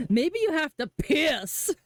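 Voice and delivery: slow, heavy punch on "piss"; stress on piss. Retold or imitated. Streamer imitating Marston, stressing "piss". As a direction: lean hard into "piss", slowly. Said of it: "so much emphasis on piss".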